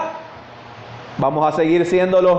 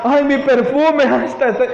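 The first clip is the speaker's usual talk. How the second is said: mock lamenting woman; 6 semitones higher than usual. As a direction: play a woman moaning in regret, hammed up. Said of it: "No se puso a llorar".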